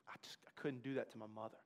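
soft low whisper; whispered confession. Acted. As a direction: breathe it out as a secret. Confessing he couldn't hurt mother.

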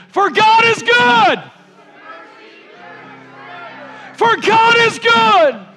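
slow, high, loud call to the congregation; preacher shouting the call for the response; shouted call. Real, not acted. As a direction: lead the call, stretching every word. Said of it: "I'm gonna shout".

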